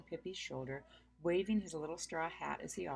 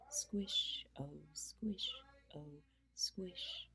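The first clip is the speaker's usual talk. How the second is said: soft unvoiced fading squishes; whispered squish; 7 dB quieter, much slower than usual. A narrator's reading. Pippi whispering the end of her song. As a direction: let the squishes fade to a whisper.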